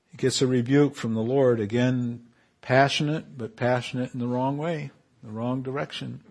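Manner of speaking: ordinary talk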